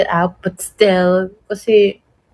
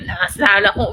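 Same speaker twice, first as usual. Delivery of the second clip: ordinary talk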